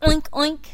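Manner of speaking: pig oinking. Acted. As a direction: snort it like a pig. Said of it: "said Pig".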